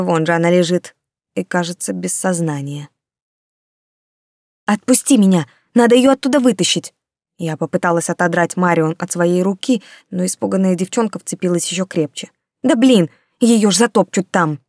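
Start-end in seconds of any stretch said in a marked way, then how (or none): 4.7-6.9 urgent shout. Acted. Narrator fighting to pull free. 12.6-14.6 urgent shout. Acted. Frantic struggle with Marion.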